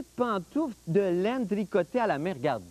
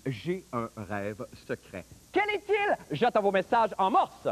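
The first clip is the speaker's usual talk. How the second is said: ordinary talk, nothing marked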